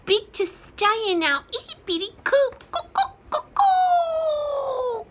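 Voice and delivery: high, loud rooster cackle; crowing rooster. Acted. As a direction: crow it out indignantly. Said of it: "crows the rooster".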